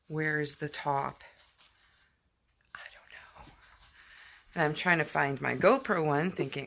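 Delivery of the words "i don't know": a hushed aside, no voice; whisper. Real, not acted. Vlogger searching the dark house, whispering. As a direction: breathe it under your breath. Searching at night, mom asleep.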